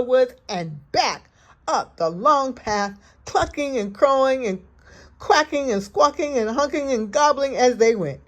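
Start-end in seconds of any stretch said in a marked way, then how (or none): none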